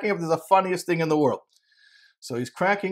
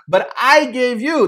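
high, loud and slow, booming reproach; rebuking God; 8 semitones higher, 6 dB louder, slower than usual. Acted. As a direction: thunder it down as God rebuking his people.

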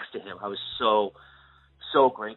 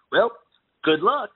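teasing good luck; 9 semitones higher, 7 dB louder than usual. Retold or imitated. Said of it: "and he goes".

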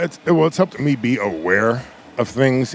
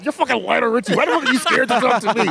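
high, rapid disbelief breaking into laughter; retold starstruck outburst; incredulous protest; 12 semitones higher, faster than usual. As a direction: exclaim it way up high, amused and baffled. Retelling his incredulous reply to star.

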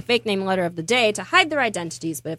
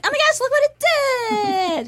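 high, slow, gushing "oh my gosh"; mock amazement; slower than usual. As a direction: gasp it out like you can't believe it. Mock amazed reaction to push-up.

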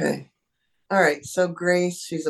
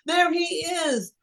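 high, breathless excited dog voice; 10 semitones higher than usual. Pretend. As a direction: gush like a dog spotting its favorite person. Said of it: "So I would start out with".

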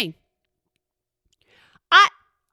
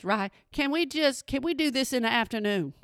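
ordinary talk, nothing marked

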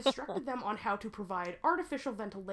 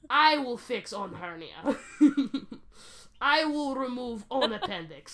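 put-on Russian accent; 3 semitones higher, 6 dB louder than usual. Mock Russian surgeon refusing help.

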